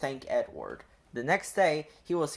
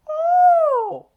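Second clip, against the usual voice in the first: very high, loud squeal of surprise; a sudden high shriek from the silly trucks; trucks' startled cry; 12 dB louder than usual. A narrator's reading. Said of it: "they cried".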